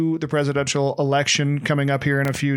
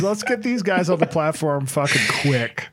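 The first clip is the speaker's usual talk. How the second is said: Spotify exec voice; 4 semitones higher than usual. Retold or imitated. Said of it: "execs at Spotify are probably like".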